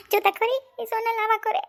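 high, upset outcry. Acted. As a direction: cry out in high-pitched protest. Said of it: "જરાય તું રાડું તો નાખતી જ નહીં".

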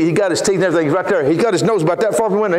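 usual speaking voice

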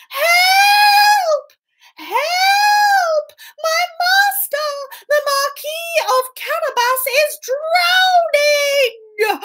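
very high, stretched-out alarm call; shouted cry for help. Acted. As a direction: call across the river for rescue. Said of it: "started shouting".

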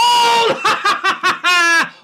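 loud high burst of laughter; shouted laugh. Real, not acted. Fan laughing loudly as the goal goes in.

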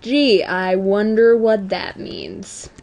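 slow, drawn-out sarcasm; sarcastic. Real, not acted. Gamer pretending not to get an obvious hint, sarcastic. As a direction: feign wondering, laying the sarcasm on slowly. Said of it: "do you hear the sarcasm in my voice".

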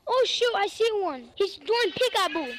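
normal talking voice

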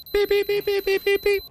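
high mock beeps; imitated beeping. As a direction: beep like a little machine.

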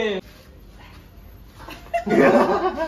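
high-pitched loud laugh; high laughing burst. Real, not acted. Joke about buying everything.

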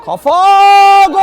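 slow, drawn-out loud goal call; goal shout. Real, not acted. Goal call in live commentary. (uzatılmış gol çığlığı)

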